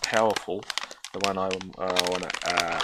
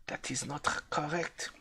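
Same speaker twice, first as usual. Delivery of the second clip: put-on German accent; 6 semitones higher, 10 dB quieter than usual. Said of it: "why am I doing this stupid German accent".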